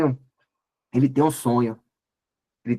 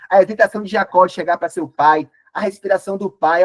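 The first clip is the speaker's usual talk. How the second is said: ordinary delivery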